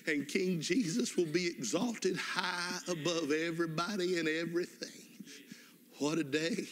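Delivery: rising, fervent preaching. Real, not acted. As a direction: build them up toward heaven. Preaching climax on heaven.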